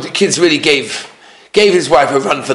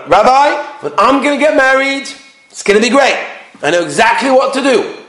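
mock overconfidence; 8 semitones higher than usual. Cocky young man before marriage.

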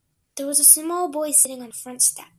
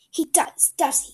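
scornful snort; 4 semitones higher, 9 dB louder than usual. Acted. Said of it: "Cook gave a snort".

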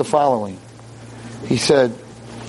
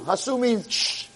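ordinary delivery

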